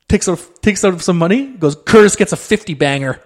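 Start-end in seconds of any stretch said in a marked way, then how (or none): 1.7-3.2 loud. Retold or imitated. Ty's generous announcement.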